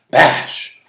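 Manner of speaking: ordinary delivery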